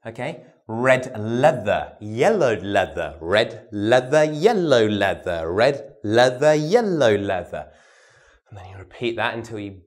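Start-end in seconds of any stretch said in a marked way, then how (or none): 0.7-7.7 "leather" with a clear voiced th, tongue to teeth; clear th tongue twister. Shown as a demonstration. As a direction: say "red leather, yellow leather" deliberately and loud. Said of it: "a test of this T H sound".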